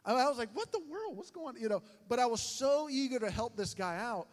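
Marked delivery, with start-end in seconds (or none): 0.5-1.6 high, startled questioning; mock bewilderment. Tourist feeling hand on wallet, high-pitched bewilderment. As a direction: voice jumps up in puzzled alarm. Feels wallet being stolen.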